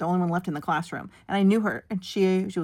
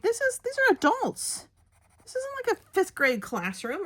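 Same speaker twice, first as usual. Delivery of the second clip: much higher, slow disbelieving squeak; incredulous protest; 13 semitones higher than usual. Retold or imitated. Teacher retells protesting to the students' treatment, incredulous. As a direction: make it sound absurd.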